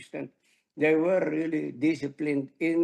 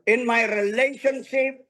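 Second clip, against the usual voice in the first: leading a declaration; 5 semitones higher, 3 dB louder than usual. Shown as a demonstration. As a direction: proclaim it with building conviction. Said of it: "Say it with your mouth". (masiglang pagpapahayag ng pananampalataya)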